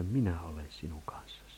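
soft, low whisper of reassurance; whispered promise. Retold or imitated. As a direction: breathe the promise gently, like God to a frightened child.

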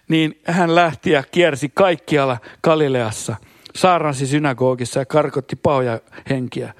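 usual speaking voice

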